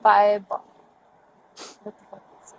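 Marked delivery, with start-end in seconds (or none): 1.8-2.5 muttered. Real, not acted. Muttering under breath at a stop.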